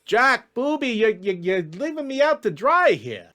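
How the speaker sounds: high, stammering plea to a pal; betrayed elf pleading in a high voice; desperate elf schemer. Acted. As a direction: whine that your buddy abandoned you.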